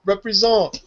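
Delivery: high French Canadian cheer, last syllable stretched; French Canadian accent. Retold or imitated. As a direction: imitate French Canadian kids hyping you up. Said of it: "we're like".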